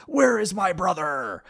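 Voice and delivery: high, loud demanding yell. Retold or imitated. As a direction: shout it at him, furious about the brother. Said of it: "Kaiba yelling at Pegasus".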